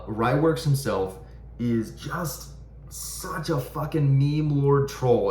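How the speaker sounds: drawn-out contempt on meme lord troll; scornful rant. Real, not acted. Venting to viewers about Ryworks, slow scornful emphasis. Ranting about a knife maker.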